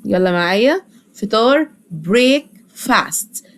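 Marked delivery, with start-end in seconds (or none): 1.9-3.5 slow spelled-out demo. Shown as a demonstration. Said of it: "بنقسمها لكلمتين".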